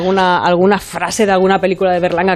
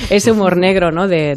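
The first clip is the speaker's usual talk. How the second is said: normal talking voice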